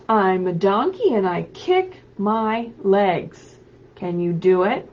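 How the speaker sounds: loud, lively picture-book read-aloud; animated storybook voice calling out to children. Acted. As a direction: read it big for little kids at home. Reads picture book aloud to kids.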